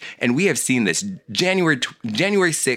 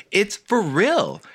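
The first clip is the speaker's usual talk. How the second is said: emphatic insistence; 12 semitones higher, slower than usual. Real, not acted. Insisting against the joke.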